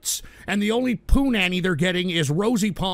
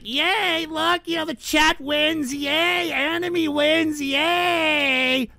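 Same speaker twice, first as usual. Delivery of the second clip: high, slow, sing-song mocking yay; mock effeminate cheering; 8 semitones higher, much slower than usual. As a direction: prance it out like a cheering anime fan. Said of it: "I talk like an effeminate idiot".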